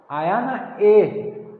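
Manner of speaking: आया ना ए with the ए held very long and slow; stretched final e. Shown as a demonstration. Teacher to class, stretching ए slowly to show a pluta vowel. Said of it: "वही तो है प्लुत स्वर".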